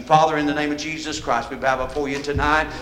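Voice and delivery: low, earnest spoken prayer; congregational prayer. Real, not acted. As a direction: lead the church in thanks to God. Preacher prays aloud.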